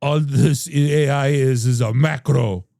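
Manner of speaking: slow, bad French accent. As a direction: attempt a clumsy French accent. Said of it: "I can't do a French accent".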